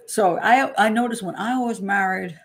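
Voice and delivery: normal talking voice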